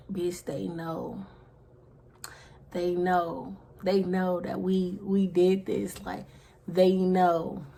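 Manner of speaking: slow, soft worried words; telling friend they were found out; hushed dread. Retold or imitated. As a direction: whisper it slowly, fearing the worst. Dread, telling friend they're caught.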